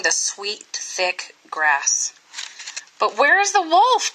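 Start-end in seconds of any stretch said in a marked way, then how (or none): 3.0-4.1 high, bewildered call; puzzled cry. Acted. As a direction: ask it high, baffled and searching. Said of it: "cried the people".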